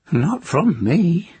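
put-on high, cracked old crone gossiping; nosy old village witch. A narrator's reading. Moss speaks.

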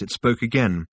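ordinary delivery

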